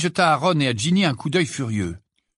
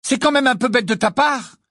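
high, loud angry outburst; losing her temper; 12 semitones higher, 5 dB louder than usual. A narrator's reading. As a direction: flare up, voice raised and pitched high. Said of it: "s'emporta Ginny". (emportée, voix forte et aiguë)

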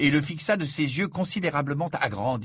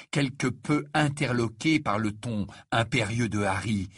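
normal talking voice

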